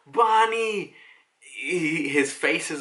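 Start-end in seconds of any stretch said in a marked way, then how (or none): 0.1-0.9 high-pitched mock yell of a name; drawn-out, high imitation shout; retold yell. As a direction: call out the name as if yelling. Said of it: "Sanji yells out".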